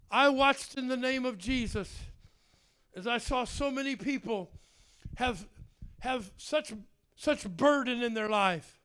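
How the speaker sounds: normal talking voice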